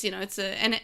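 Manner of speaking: ordinary talk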